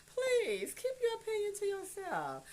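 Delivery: high, slow, crying-voiced brush-off; mock tearful sass. Mother brushing off a commenter in a high mock wail. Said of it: "I'd be like".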